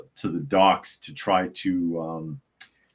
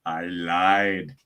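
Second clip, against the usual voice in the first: deadpan action hero; 3 semitones lower than usual. Acted. Movie hero's reply to the plea.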